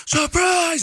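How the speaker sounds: high, loud "surprise" yell; party surprise shout. Retold or imitated. Guests greeting him at his birthday party, mock surprise shout.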